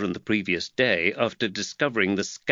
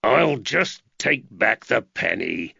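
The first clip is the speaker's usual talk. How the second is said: growl; slower than usual. Acted. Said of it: "Woods growled".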